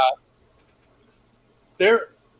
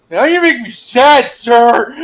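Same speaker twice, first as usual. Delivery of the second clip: much higher, louder, slow mock whimper; hammed-up sad whine; 8 semitones higher, 11 dB louder, slower than usual. Recruit touched by the instructor's news, mock sad whine. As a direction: overplay being choked up.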